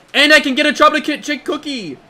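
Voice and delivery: high, loud excited squeal. Real, not acted. Streamer to chat, squealing with delight over a cookie.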